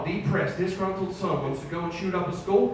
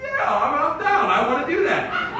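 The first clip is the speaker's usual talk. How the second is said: high, loud mock enthusiasm; keen, pitched-up yes; eager agreement; 12 semitones higher, 5 dB louder, slower than usual. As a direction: jump at the offer. Eagerly accepts imagined day of play.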